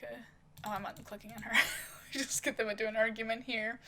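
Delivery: ordinary talk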